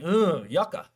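a grossed-out "ugh, yucca"; mock disgust. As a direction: recoil at the thought of sharing. Mock disgust at shared bottle.

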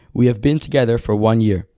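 ordinary talk

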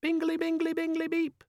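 high chirpy muffled gadget voice; muffled cheerful chirp. Acted. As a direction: chirp it, muffled under sand. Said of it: "a cheerful, if somewhat muffled voice".

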